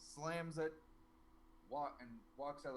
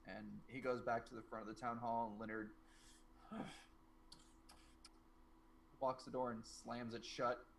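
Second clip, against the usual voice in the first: normal talking voice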